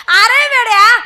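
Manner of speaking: wife scolding her husband, loud and high. Acted. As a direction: tell the fool off loudly.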